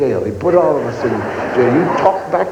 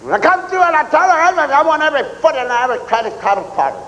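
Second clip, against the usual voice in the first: fast sergeant rant; 8 semitones higher, much faster than usual. Retold or imitated. Drill sergeant voiced in story.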